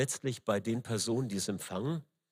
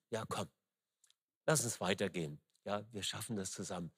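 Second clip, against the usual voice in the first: softer and lower, kind coaxing words; gentle comfort; 5 semitones lower, 7 dB quieter than usual. Shown as a demonstration. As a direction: help someone up with a soft voice. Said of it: "Betonung ... auf Sanftheit und auf freundlich reden".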